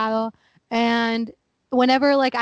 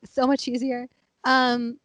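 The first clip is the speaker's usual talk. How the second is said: ordinary talk, nothing marked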